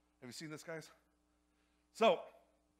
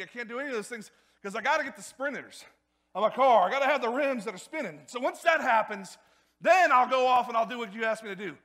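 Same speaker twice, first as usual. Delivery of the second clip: loud, high-pitched mock pleading with God; insistent bargaining; 5 semitones higher, 11 dB louder than usual. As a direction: play someone demanding it first, raised and emphatic. Voicing someone bargaining with God.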